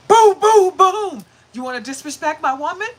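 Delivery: loud, high mock confrontation; husband storming over to confront rude neighbour, loud and aggressive. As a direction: burst in like a protective husband, booming. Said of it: "like, aggressive".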